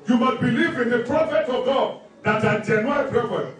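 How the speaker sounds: ordinary talk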